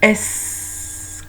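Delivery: "S" named with a long hissed ending; "S" trailing into a snake hiss; letter S. Shown as a demonstration. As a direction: draw "S" out as esssss. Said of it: "qui fait comme un serpent".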